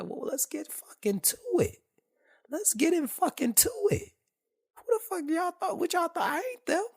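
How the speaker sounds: high, loud mock confrontation; squaring up to the corporals, high and loud; heated retort.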